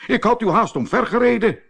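high, fast indignant outburst; calling out. A narrator's reading. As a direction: cry out a hurried reproach. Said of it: "riep de Pat".

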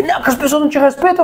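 fast, high, snippy comeback; mocking retort. Relative fires back at the narrator, fast mock snark. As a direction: fire it back quick and snide.

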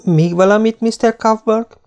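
high, bright and cheerful. A narrator's reading. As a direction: chirp the question brightly. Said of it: "vidáman megkérdezte".

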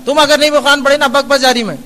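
nagging in a hard-tempered way; a hint of a sharp, stern scolding; harsh scolding. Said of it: "سخت مزاجی".

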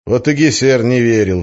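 usual speaking voice

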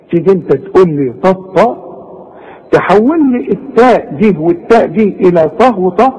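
ordinary delivery

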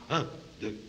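soft clipped marching count. Acted. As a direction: count the steps like a toy soldier.

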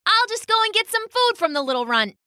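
high, quick bird voice; cocky pheasant. Acted. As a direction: toss it off fast and high like a bossy bird. Pheasant boasts to friends.

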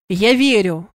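forceful, mildly shouted assurance; loud cry. A narrator's reading. Sandr breaks through her hysteria, restrained shout. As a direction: call it out firmly to get through. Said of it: "громко крикнул Сандр".

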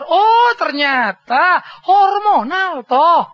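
usual speaking voice

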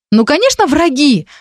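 high-pitched indignant exclamation; exclaiming. A narrator's reading. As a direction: exclaim it as obvious, voice jumping up. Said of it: "воскликнула Вика". (возмущённо воскликнуть)

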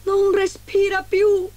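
high, loud, drawn-out shout; alarmed cry. A narrator's reading. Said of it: "gridò il primo nano".

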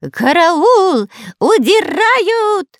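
very high, loud panicked squeal; shrill squeal. Acted. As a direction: squeal in a strange voice. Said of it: "не своим голосом заверещал Дырка".

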